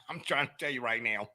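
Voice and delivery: usual speaking voice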